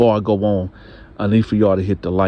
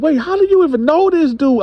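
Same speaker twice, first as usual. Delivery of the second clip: much higher, pressing; suspicious anger; 5 dB louder than usual. Retold or imitated. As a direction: grill her hard. Husband questions, wife answers.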